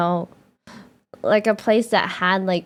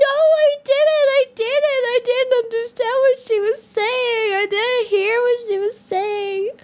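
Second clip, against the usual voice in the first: high, giggling no I didn't; laughing protest; 13 semitones higher than usual. Retold or imitated. Fufu protesting she never heard the waitress, laughing high. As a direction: squeal the denial through laughter. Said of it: "And then Fufu was like".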